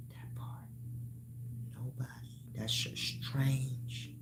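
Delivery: whispered, slow aside. Real, not acted. Whispering to the audience that it's strange. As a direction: breathe it out like a secret. Hushed aside after rhetorical question.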